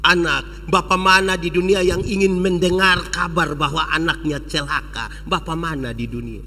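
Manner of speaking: put-on gentle father comforting his child; tender father. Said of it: "Sambil sapu-sapu di kepala".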